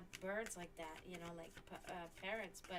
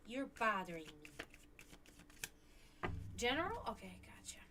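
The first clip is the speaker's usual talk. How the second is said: normal talking voice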